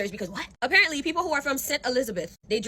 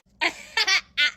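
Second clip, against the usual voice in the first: 1 embarrassed laugh; 8 semitones higher than usual. Real, not acted. Laughs at his own guess.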